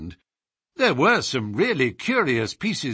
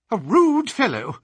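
indignant remark; 10 semitones higher, 4 dB louder than usual. Acted. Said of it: "flushing with anger".

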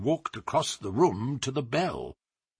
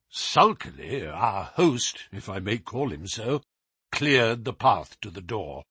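plain reading voice

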